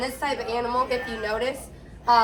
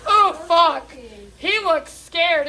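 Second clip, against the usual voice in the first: mock scared; 3 semitones higher, 5 dB louder, much slower than usual. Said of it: "looking him in the eyes like".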